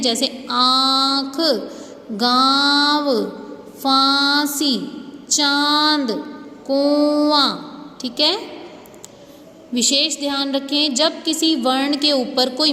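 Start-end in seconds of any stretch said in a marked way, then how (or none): 0.0-7.6 slow, each word's nasal vowel drawn out through the nose; nasalised words. Shown as a demonstration. Teacher sounding nasal words for the class. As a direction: sound "आँख", "गाँव", "फांसी", "चांद", "कुआं" slowly with nasal vowels. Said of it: "अनुनासिक कहते हैं".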